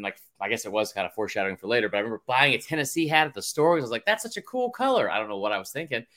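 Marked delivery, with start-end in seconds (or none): 4.1-5.1 put-on high, innocent kid voice; a light imitation of his child self; naive kid voice.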